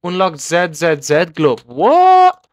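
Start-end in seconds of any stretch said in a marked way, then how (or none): 1.7-2.5 very high, loud drawn-out shriek; astonished yell. Real, not acted. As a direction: burst out in sheer disbelief. Surprised by unlocked glove.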